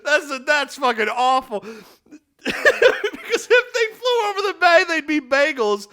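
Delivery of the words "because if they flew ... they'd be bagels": laughing. Real, not acted. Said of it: "rereading joke while laughing".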